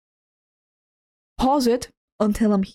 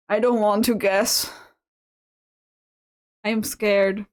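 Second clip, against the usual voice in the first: ordinary delivery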